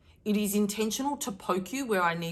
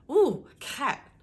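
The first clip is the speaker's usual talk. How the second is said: light imitation of mum, higher and louder; mum's voice; 7 semitones higher, 6 dB louder than usual. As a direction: tease like mum catching her out. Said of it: "when my mum says".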